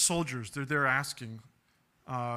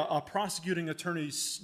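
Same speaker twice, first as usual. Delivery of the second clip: usual speaking voice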